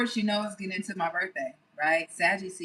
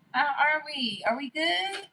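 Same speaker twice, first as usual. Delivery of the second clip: higher cooing question; high sweet check-in; 7 semitones higher than usual. Acted. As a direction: coo at the viewers. Checking in with viewers.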